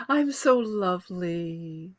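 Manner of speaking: lightly voiced, slow princess. Shown as a demonstration. Guest answering the host's request, lightly voiced vain princess. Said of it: "How would you do a princess voice?".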